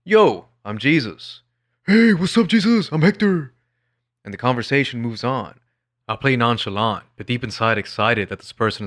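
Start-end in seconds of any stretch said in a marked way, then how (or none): 1.9-3.5 much higher, louder cheerful guy voice; friendly acceptance. Retold or imitated. Hector greeting him warmly, bright high friendly voice. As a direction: greet him like an old buddy. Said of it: "The pleasant one is one of immediate acceptance".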